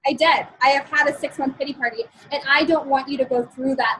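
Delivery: ordinary delivery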